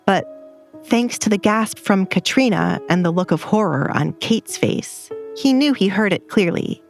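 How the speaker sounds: plain reading voice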